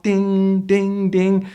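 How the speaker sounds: high "ding, ding" like an app alert; ping like a messenger going off; imitated messenger ping. Coach mimicking messenger pings in an interview, high and light. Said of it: "your messenger is going off".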